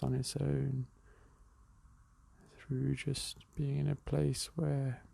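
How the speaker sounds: calm, unhurried meditation guide with long pauses; even, measured breathing-exercise voice; guided meditation. Real, not acted.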